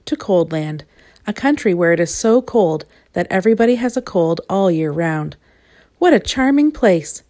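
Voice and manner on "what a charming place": high, bright fake cheer; shivering girl fakes delight; pretending to be pleased. A narrator's reading. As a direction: put on a brave face. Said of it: "shivering and trying to look as if".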